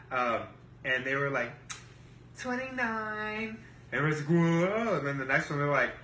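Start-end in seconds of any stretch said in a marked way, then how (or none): 2.4-3.6 high, slow, drawn-out imitation; mimicked rival women. Rivals announcing their points to the table, high slow mimicry. 4.3-5.0 loud, high, drawn-out whoa; imitated crowd amazement. Crowd reacting to a high score, loud imitated awe.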